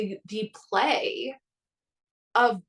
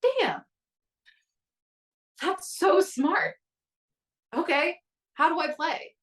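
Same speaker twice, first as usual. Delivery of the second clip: impressed excitement; 5 semitones higher, slower than usual. Retold or imitated. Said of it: "And I was like".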